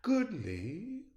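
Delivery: ordinary delivery, nothing marked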